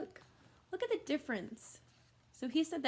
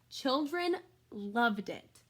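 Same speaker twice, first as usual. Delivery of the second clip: slowed down; much slower than usual. Acted. Slow emphatic story line.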